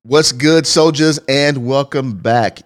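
loud, upbeat show intro; podcast announcer. Acted. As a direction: open the show like a hype host.

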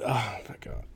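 hushed oh my God under the breath; a real exasperated whisper; whispered disbelief. Real, not acted. Exasperated at wasted segment.

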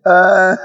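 high squealing laugh; cracking up. Real, not acted. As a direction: lose it laughing. Cracks up at the Big Mac joke.

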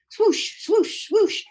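high whooshing ski sound; skiing swoosh noise. Retold or imitated. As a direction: swoop up high like skis on snow.

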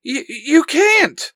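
high, loud stammered cry; stammer, then cry out in protest; shouted protest. A narrator's reading. Helena protests being handed over, shouting. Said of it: "Helena shouts".

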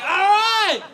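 high, loud yell back; shouted reply. Acted. Kid answering his dad across the line, shouted reply. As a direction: holler it back, drawn high.

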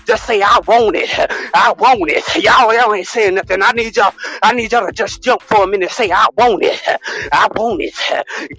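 mock preaching. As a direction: whip the congregation up like a fired-up preacher.

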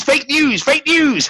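high, mocking repeated fake news; mocking singsong. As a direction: toss it off in a high mocking lilt. Mocking Facebook's accusation.

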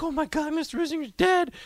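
high, lightly voiced kids gasping; mock horror like schoolkids; shocked kids. Kids react to teacher's arrest.

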